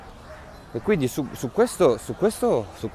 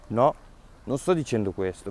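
ordinary talk, nothing marked

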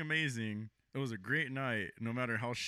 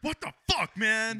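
pitched way up, loud and indignant; outraged disbelief; 7 semitones higher, 5 dB louder, slower than usual. Retold or imitated. Driver venting at the toll road in sudden loud outrage. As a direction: yelp it like a driver who just hit a surprise toll. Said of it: "I'm like".

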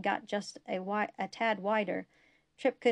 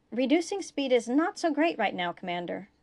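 higher, louder alarmed protest; panicked; 4 semitones higher, 5 dB louder than usual. A narrator's reading. Pilot protesting to his commander, panicked. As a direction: push back in rising panic. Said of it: "Travis sounded panicked".

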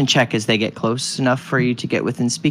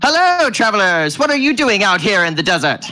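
higher, hailing little-man voice; little bald man; 4 semitones higher than usual. Acted. Tiny man greeting travelers in the desert, higher character voice. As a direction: greet strangers as a tiny, chatty fellow. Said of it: "a little bald man standing about".

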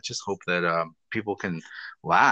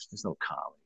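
quiet; 8 semitones lower, 13 dB quieter, faster than usual. Retold or imitated. Apathetic comic this year.

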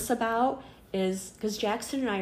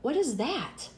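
imitating a puzzled daughter, higher pitch; higher-pitched retelling of a child's question; 5 semitones higher than usual. Daughter asks mom about Dad's bracelet, imitated puzzled child.

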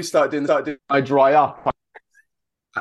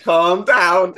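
raised, higher-pitched quoted plea; mock-urgent raised voice; imitating an outburst; 6 semitones higher, 7 dB louder than usual. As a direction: blurt it out louder and higher, as a retold line. Retold reassurance blurted louder.

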